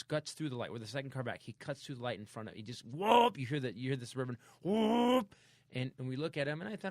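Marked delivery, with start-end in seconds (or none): 2.9-3.4 whoop sound effect. Retold or imitated. Car flip sound effect. 4.6-5.3 loud, high whoosh of a flipping car; whoop sound effect. Retold or imitated. Telling a friend the crash, loud whoop.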